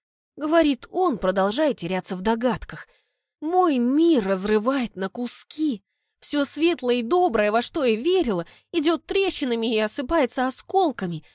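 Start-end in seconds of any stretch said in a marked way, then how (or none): none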